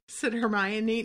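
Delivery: plain reading voice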